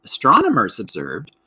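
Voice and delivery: ordinary talk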